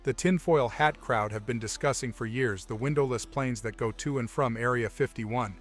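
usual speaking voice

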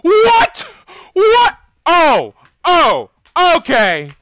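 high, loud outraged yells; shocked shouting. Real, not acted. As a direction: yell at the game in disbelief. Game kills him again, shouting.

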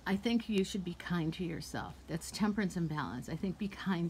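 breathy whispered advice. Real, not acted. Whispering kind advice to a viewer, soft. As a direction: lean in and whisper it gently.

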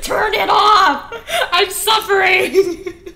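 loud, drawn-out mock wail; hammed-up cry of distress; upset computer. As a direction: wail it high as a suffering machine, half laughing. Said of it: "She's so upset".

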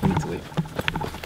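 whispering. Real, not acted. Lost in woods, frightened.

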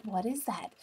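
soft, puzzled question; hushed wondering. Retold or imitated. Girl waking to a tapping sound, soft and puzzled. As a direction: ask it softly, half asleep. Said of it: "and she's like".

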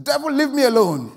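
high, loud yell of rebuke. Retold or imitated. Woken preacher telling the devil off, yelling. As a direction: yell it as if ordering a pest away. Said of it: "he yelled again".

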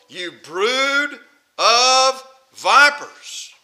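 slow, drawn-out denunciation; very slow, emphatic condemnation; upset rebuke. Acted. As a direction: stretch each word out as a scathing accusation. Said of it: "Jesus is upset".

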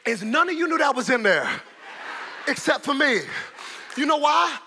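loud, high preaching, nearly shouting; fiery preacher stirring the congregation; preaching at full force. Acted. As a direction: whip the church up with shouted calls.

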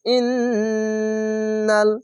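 ইন্নাল chanted with a long hummed n; held nasal ghunnah. Shown as a demonstration. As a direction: hold the doubled n of ইন্নাল through the nose. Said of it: "এখানে ওয়াজিব গুন্না".

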